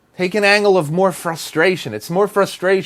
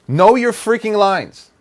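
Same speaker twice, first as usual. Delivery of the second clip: high, slow, exasperated emphasis; exasperated insistence; 6 semitones higher, 3 dB louder, slower than usual. Real, not acted. Director fed up with unprepared actors, exasperated insistence. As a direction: drive it home, fed up.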